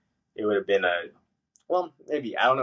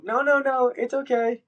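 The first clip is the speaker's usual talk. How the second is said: a high, louder chirpy "it's okay" over real anger; forced cheerful "no, no"; 14 semitones higher, 3 dB louder than usual. Retold or imitated. To the staff after forty-five minutes of waiting, a high, strained "it's okay". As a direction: smother the irritation with high politeness. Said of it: "and you still go".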